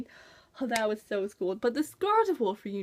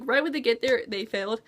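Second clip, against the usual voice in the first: ordinary talk, nothing marked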